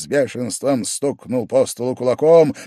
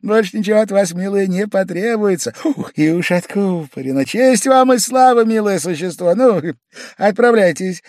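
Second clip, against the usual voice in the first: high, loud bustling glee; a host overjoyed at the rescue; fussy delighted orders; 12 semitones higher, 9 dB louder than usual. A narrator's reading. Pavel Pavlovich bustling the maid about glasses, high and loud.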